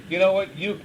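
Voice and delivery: ordinary talk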